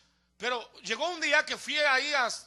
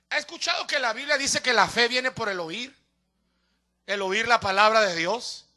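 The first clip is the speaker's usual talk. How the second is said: ordinary talk, nothing marked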